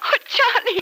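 high, breathless cry of joy; overjoyed surprise. Acted. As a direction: gasp his name in disbelief. Son suddenly returns home.

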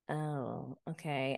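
low, soft, unimpressed acknowledgement; underwhelmed. Retold or imitated. Woman answering a man's drinking excuse, quietly underwhelmed. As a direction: let it drop quietly, unconvinced. Underwhelmed reply to his boast.

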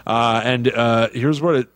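ordinary talk, nothing marked

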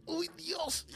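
very high, soft gasped Dios; hushed high-pitched climax cry; high mock gasp. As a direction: squeak it out at the peak. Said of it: "y de repente dice".

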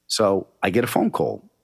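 ordinary talk

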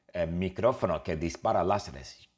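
ordinary talk

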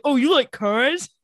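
slow condescending. Retold or imitated. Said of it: "Stefan's like".

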